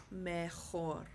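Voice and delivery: mejor said meh-HOR; stress on the O. Shown as a demonstration. Teacher showing where stress goes, mejor stressed on HOR. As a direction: land on the O of mejor. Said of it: "The stress goes on the O".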